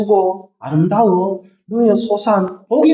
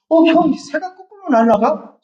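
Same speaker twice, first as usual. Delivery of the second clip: naive Abel; 8 semitones higher than usual. Retold or imitated.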